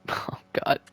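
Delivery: Oh, God whispered under the breath; a nervous whisper to himself. Real, not acted. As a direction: breathe it out, anxious. Nervous before the third period.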